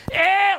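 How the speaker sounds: high, loud strained bleat; forced loud voice. Shown as a demonstration. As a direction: demonstrate forcing volume through a tight throat. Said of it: "Have a louder voice".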